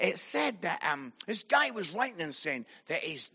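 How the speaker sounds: ordinary talk, nothing marked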